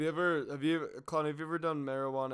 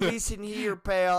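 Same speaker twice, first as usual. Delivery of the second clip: put-on Texan accent; 5 semitones higher, 5 dB louder, slower than usual. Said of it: "your awful fucking Texan accents".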